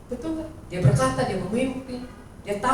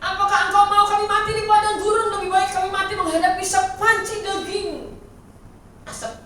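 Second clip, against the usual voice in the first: high, loud, indignant grumbling; whining crowd complaint; 11 semitones higher, 8 dB louder than usual. Retold or imitated. Israelites complaining to Moses in the desert, high and loud. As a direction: moan at Moses as an ungrateful mob. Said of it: "Apa yang dia dapat, bangsa Israel?".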